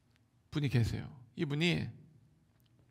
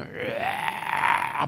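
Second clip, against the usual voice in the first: long, high imitated possessed yell; changed-voice demonic roar; 9 semitones higher, 4 dB louder, much slower than usual. Preacher showing how the possessed person's voice changes, long roar. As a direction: let out a drawn-out, loud mock demon cry. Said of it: "목소리도 변해요".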